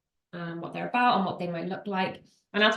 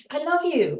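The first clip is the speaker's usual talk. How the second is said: high, louder plea; hammed-up declaration of love, raised to get through; acted insistence; 9 semitones higher, 5 dB louder than usual. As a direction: insist on it, pitched up and loud. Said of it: "And I'm like".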